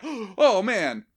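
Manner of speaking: high, loud mock-shocked reaction. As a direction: gasp it like a stunned fan. Acted audience reaction after gasp.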